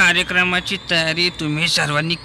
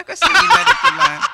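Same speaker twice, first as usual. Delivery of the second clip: high laughing taunt; 7 dB louder than usual. Acted. Mocking a clumsy friend.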